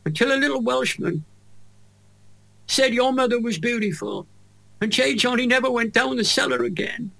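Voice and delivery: choked up, voice pitched high; close to tears. Real, not acted. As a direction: fight back real tears while finishing the story. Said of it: "I'm gonna cry now".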